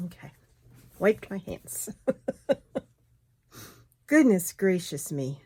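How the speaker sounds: ordinary talk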